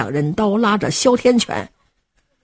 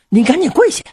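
ordinary delivery, nothing marked

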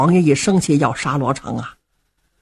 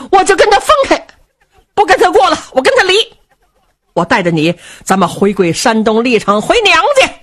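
put-on indignant old mother; 9 semitones higher, 4 dB louder, faster than usual. Old mother threatens divorce.

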